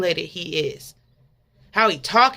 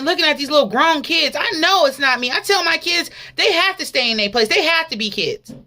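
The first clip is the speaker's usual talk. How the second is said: fervent preaching, raised and pressing; 9 semitones higher, 5 dB louder than usual. Real, not acted. Preacher warning the congregation, fervent preaching. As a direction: drive the message home to the church.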